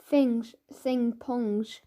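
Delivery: drawn-out, slow pongs; mother speaks through her handkerchief at the smell; very slow disgust. Acted. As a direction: stretch every word out in disgust.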